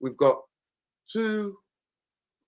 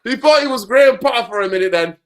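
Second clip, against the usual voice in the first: mocking joke; 9 semitones higher, 10 dB louder than usual. Real, not acted.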